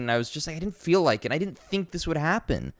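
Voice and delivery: ordinary talk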